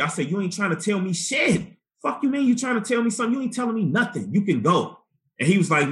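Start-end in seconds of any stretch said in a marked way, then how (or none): 0.3-5.0 mock heated rant, higher and faster; retold angry outburst. Telling off the probation officer, heated and fast. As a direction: fire it back at him, fast and pitched up.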